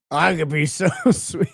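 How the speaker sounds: mock the line while laughing; laughing mock quote. Reacting to the guy's line, laughing while mocking it. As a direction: giggle through his line, teasing. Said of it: "quotes the line".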